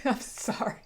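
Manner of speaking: breathy laughing sorry; giggling sorry; laughing apology. Real, not acted.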